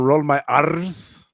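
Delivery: "R's" with a long trilled R; rolled R. Shown as a demonstration. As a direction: roll the R at length on "R's". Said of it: "You think I couldn't roll my R's".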